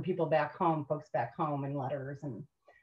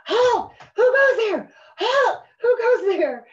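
high, very loud challenge; hysterical sentry yell; 9 dB louder than usual. Retold or imitated. Sleepwalking recruit with rifle outside pup tent, hysterical yelling. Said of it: "I'll start yelling about things".

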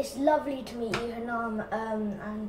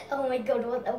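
ordinary delivery

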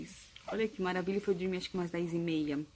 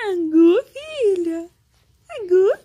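excited calling; 12 semitones higher, 16 dB louder, much slower than usual. Real, not acted. Mãe chamando a bebê. (chamando a bebê, animada)

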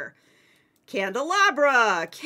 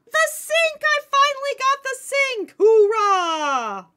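high, loud cry of triumph; excited shout; 13 semitones higher, 7 dB louder than usual. Real, not acted. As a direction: yell with joy at the win. Finally got the sink.

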